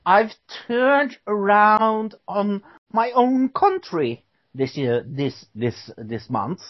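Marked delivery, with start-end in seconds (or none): 0.1-4.2 slow, higher put-on English voice; mock British accent. Host joking that he has turned British, mock British accent. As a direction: play the newly converted Brit. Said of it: "the accent's totally working for you".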